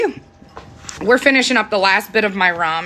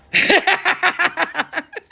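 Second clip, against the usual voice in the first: quick high burst of laughter; 10 semitones higher than usual. Real, not acted. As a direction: laugh at your own joke. Laughs at the boss joke.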